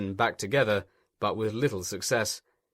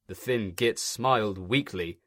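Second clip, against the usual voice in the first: ordinary delivery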